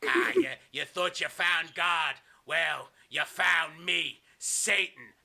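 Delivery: very slow, loud villain pronouncement; hammed-up satanic taunt; Kevin Sullivan. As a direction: stretch out each word with mock evil relish. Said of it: "he lays it next to Nancy and he goes".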